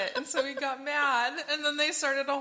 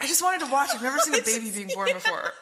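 higher, louder, fast pleading dragon; whiny dragon; 3 semitones higher, 4 dB louder, faster than usual. Acted. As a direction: rush the excuse as the dragon, laughing. Dragon voiced whining.

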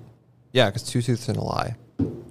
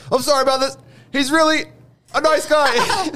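high, polite apology voice; movie character voice. Retold or imitated. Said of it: "I just remembered something else during that sequence".

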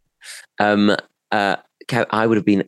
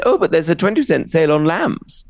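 posh shop lady; 9 semitones higher than usual. Retold or imitated. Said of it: "she said".

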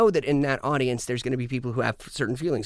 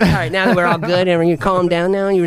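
mock scolding; 7 semitones higher, 8 dB louder than usual. Mock confronting the banana eater.

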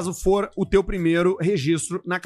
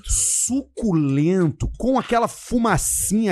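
savouring; slower than usual. Acted. Host selling juicy chicken to listeners, slow savouring. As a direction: stretch it out as if tasting it. Savouring food in sponsor read.

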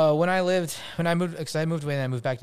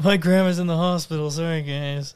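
loud, higher fake apology; mock excuse; 5 semitones higher, 7 dB louder than usual. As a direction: make a hammed-up excuse to bail out. Playing along with fake excuses.

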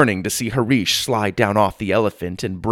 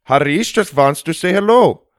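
put-on higher Indian-accented greeting; 5 semitones higher, 3 dB louder than usual. A narrator's reading. Elephant rider greeting the family, friendly Indian-accented voice.

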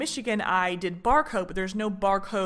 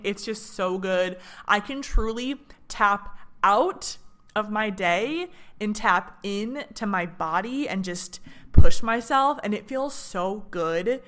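ordinary talk

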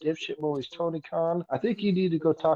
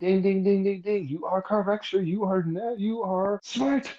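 high, ringing bell imitation into praise; mock quiz host; 3 semitones higher than usual. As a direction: announce the correct answer like a game show. Mimics quiz bell and praises answer.

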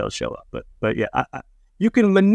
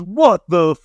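a touch louder and higher, slowed indignant retort; mock angry outburst; 5 semitones higher, 4 dB louder, slower than usual. Stranger hit by whiskey confronting the comedian, mock angry. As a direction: retell a stranger's angry shout. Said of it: "he bows up. He goes".

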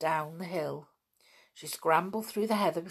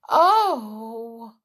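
embarrassed exclaim; 6 semitones higher, 5 dB louder, much slower than usual. A narrator's reading. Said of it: "going rather red".

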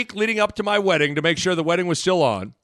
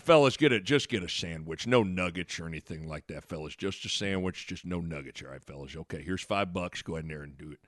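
low, hushed fussy coach; Coach Altman; 12 semitones lower, 10 dB quieter than usual. Retold or imitated. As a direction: imitate a coach mumbling instructions, trailing softer. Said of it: "Coach is always kinda psycho".

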